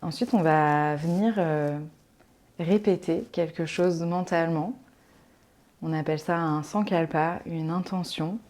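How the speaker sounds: soft, calm relaxation voice with long pauses; guided meditation. Acted. Yoga teacher settling listeners into Yoga Nidra, calm guided meditation. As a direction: ease them gently into rest, leaving space to breathe.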